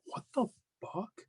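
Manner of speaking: high and hushed incredulity; softly squeaked mock shock; acted disbelief. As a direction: replay stunned disbelief, high but soft. Retelling his shock at breakup.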